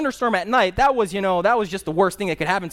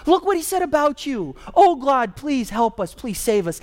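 higher, urgent plea that eases at the end; Hezekiah pleading; 6 semitones higher than usual. Retold or imitated. Hezekiah runs to God about Sennacherib's letters, pleading higher. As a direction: tattle to God like a frightened child. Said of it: "He gets to that temple and he says".

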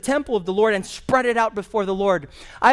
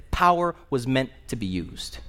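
lower, measured voice of the prime minister; a lower voice, settling the matter for good; quoting the prime minister; 8 semitones lower, 3 dB quieter than usual. Retold or imitated. Prime minister telling the airline to act, lower and measured. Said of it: "and he said".

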